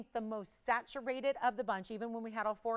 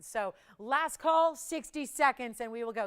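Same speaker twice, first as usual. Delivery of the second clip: calling last call; 2 semitones higher, 8 dB louder than usual. Real, not acted. Host calling out the final minute to viewers, loud. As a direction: announce it big and drawn out.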